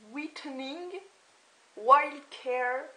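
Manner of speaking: French speaker's showy attempt at English; self-mocked English accent. Shown as a demonstration. Reading the deodorant's name to viewers, in mock English accent. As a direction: put on a joke English accent. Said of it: "super accent anglais".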